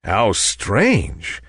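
ordinary delivery

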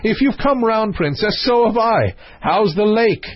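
lifted, mildly excited prince's voice; cheerful calling out. A narrator's reading. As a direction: call across brightly from the bed, pitched high. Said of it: "cried the prince from the bed".

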